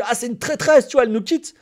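loud, high mock accusation; mock outrage. Streamer imitating the reaction he didn't have when a colleague left, mock outrage. Mocks a reaction he never had.

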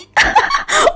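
high, triumphant cackle; gloating laugh. Acted. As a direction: cackle at the jealous guests. Gloating laugh after taunting guests.

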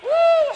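a high, loud shout of joy; joyful whoop. Real, not acted. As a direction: whoop out in praise. Preacher whoops in praise.

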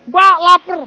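loud imitated kid's demand; mock childish shout. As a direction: call out like a hungry kid. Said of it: "kalau gua bilang gini".

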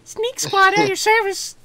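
high, squeaky nerd voice; nerdy hacker voice. Acted. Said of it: "What voice do I do?".

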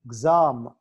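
Exam with a loud "zam"; exam, weight on the second syllable; stress at the X. Shown as a demonstration. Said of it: "X దగ్గర".

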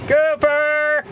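calling across the farmyard. Real, not acted. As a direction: shout his name to get his attention. Calling out to Cooper.

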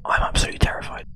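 fast whispered confession; joking whispered terror; mock ASMR whisper. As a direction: whisper it, scared, quickly. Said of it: "A S M R".

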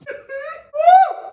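sudden high burst of laughter; helpless delighted laugh; real laugh. Real, not acted. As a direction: crack up at the first bite. Tasting the fried cookie dough.

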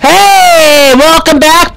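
usual speaking voice

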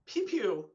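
quick playful zap noise; playful pew pew sound; mock laser pew pew. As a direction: zap it like a toy ray gun. Sound effect in game.

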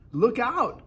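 high, loud warning shout; urgent high cry. A narrator's reading. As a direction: yell a sudden alarm. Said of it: "cried James".

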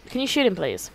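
ordinary talk

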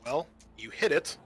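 ordinary delivery

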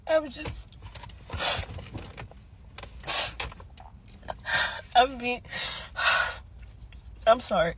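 low, soft voice worn from crying; halting, quiet, struggling to get words out; real tearful upset. Real, not acted. Woman telling viewers she's been crying since morning, tearfully. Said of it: "I've been crying since".

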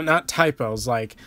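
ordinary delivery

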